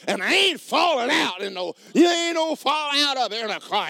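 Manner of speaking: high, loud mocking voice; hammed-up churchgoer refusing to fall; mock falling-out worshipper.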